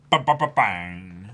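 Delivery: loud rapid bang noises; mock gunfire. Fan voicing the gunfire in the panel, loud. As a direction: spit out gunshots.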